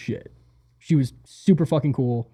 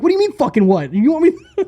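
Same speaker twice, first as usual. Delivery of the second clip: raised, louder incredulous comeback; mock exasperation; 12 semitones higher, 5 dB louder than usual. Arguing with the tour manager over boxes, mock exasperation. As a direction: throw it back in playful outrage. Retelling exasperated reply to her.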